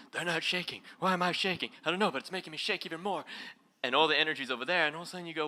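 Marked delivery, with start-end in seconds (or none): 0.1-3.2 raised, anxious inner voice, a little fast; panicky ego voice. Retold or imitated. The ego panics at the shaking, a mock nervous inner voice. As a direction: play the ego fretting about shaking. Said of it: "The ego's going".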